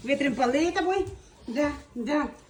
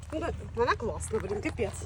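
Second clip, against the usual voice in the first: ordinary delivery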